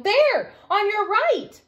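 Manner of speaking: high, loud squeal of alarm; excited squeal. Acted. Cub spots a splash and squeals to family. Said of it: "a splash and squealed".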